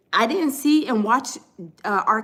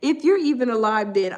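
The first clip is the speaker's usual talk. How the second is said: ordinary talk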